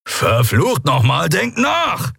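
slow, emphatic rebuke rising high; angry scolding. A narrator's reading. Kero rebukes the eager crew, angrily scolding. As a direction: lay into them, pitch climbing at the end. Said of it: "als Kero sie anfuhr".

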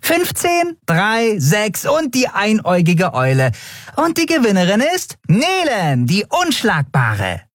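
high-pitched little winged card player, crowing; triumphant Nelen. Acted. Winged Nelen declares herself winner to Miko, high and triumphant. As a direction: announce your win in a high, showy voice, stretching the end. Nelen crows over winning hand.

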